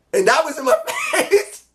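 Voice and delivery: can't help cracking up mid-line; giddy real laughter breaking through the words; laughing. Real, not acted. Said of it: "I don't know why I did that".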